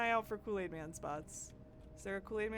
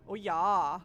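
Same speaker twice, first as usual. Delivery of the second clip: loud, exaggerated Midwestern accent; hammed-up Midwestern catchphrase; Midwestern Kool-Aid Man; 9 dB louder than usual. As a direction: holler with broad Midwestern vowels. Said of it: "do the Midwestern Kool-Aid Man".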